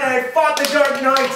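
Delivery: plain reading voice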